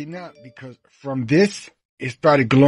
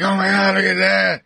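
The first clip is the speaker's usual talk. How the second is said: imitated screaming; 6 semitones higher than usual. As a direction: mimic a panicked crowd in a high, held voice. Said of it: "screaming like".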